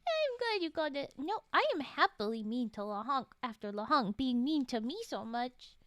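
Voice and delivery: usual speaking voice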